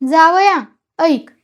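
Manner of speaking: loud, scornful call for attention. A narrator's reading. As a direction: address the son-in-law with loud disdain. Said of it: "नाक उडवीत म्हणाला".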